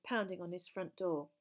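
ordinary delivery

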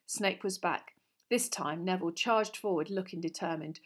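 ordinary delivery, nothing marked

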